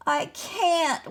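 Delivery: high, wailing refusal. A narrator's reading. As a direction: wail it out in despair. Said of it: "wailed the dragon".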